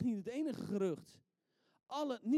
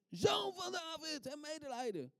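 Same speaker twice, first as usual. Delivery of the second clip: crying out; 8 semitones higher than usual. Acted. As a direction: call it out across a crowd. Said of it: "riep hij het uit".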